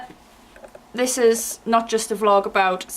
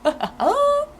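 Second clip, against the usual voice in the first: high laugh. Real, not acted.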